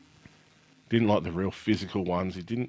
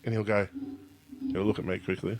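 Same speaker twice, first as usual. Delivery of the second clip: ordinary talk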